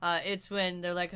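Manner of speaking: ordinary delivery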